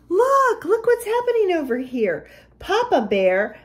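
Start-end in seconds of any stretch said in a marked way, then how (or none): 0.1-2.3 higher, excited storyteller; excited discovery. Acted. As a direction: gasp and point out a surprise. Gasps at surprise in the story.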